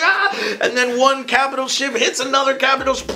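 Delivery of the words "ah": high, thrilled cry of delight; excited gasp. Retold or imitated. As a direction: yelp like a kid in a space battle. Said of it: "You're like".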